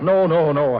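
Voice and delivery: shouting her down in a hurry; shouted refusal. Acted. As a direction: cut her off hard. Refusing to go back.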